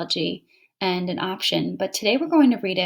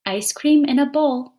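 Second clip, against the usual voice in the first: poem reading; 6 semitones higher, 6 dB louder than usual. Shown as a demonstration. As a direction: read out, a touch higher and louder. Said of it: "Now let's read the poem".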